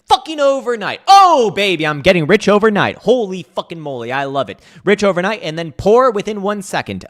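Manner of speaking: hammed-up ecstasy over overnight riches; sarcastic crypto bro celebrating; mock euphoric gambler. Mocks crypto buyer getting rich.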